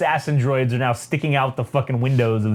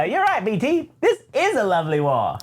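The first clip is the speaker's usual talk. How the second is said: high prissy droid voice, pitched way up; Triple Zero voice; 7 semitones higher than usual. Retold or imitated. Retelling the Star Wars comic, voicing the droid Triple Zero. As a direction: play the polite murderous protocol droid. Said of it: "he says".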